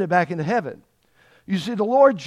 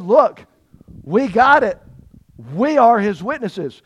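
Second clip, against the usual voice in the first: louder, with a lift; bold retort; 4 semitones higher, 6 dB louder than usual. Acted. Apostles answering the council, loud and bold. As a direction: push back, we've got it.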